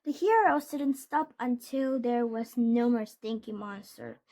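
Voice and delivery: ordinary delivery, nothing marked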